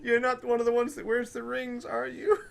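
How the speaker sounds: very high character voice; cheerful newcomer, pitched far up; cheerful Lord Francis. Acted. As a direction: ask innocently in a high, light voice.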